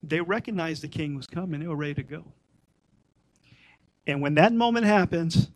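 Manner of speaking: normal talking voice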